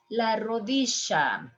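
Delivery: rodilla as "rodisha"; sh pronunciation. Shown as a demonstration. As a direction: say rodilla with a hushing sh. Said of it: "Three ways".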